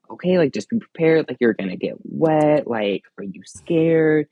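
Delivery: put-on mom preparing child. Mom preparing her little boy for the ride, lightly imitated. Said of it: "the mom was kind of preparing him".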